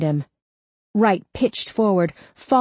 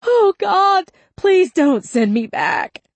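high, loud plea sinking slowly; desperate begging; 14 semitones higher, 5 dB louder, slower than usual. A narrator's reading. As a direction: cry out and beg not to be sent away. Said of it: "Wright begged".

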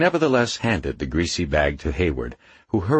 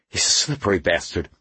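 out of breath after a struggle; lightly winded voice; panting. A narrator's reading. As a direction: pant it between breaths. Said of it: "Hayward panted".

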